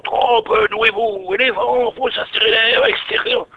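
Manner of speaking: high, slow, warped tape voice; like a tape played back at the wrong speed; mock interview. Poet replaying his elephant interview, high and slowed down. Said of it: "Et j'interviewe mon éléphant".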